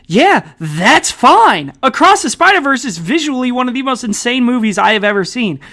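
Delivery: emphatic retort. Retold or imitated. Host answering a friend's rip-off complaint, emphatic. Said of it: "And I'm like".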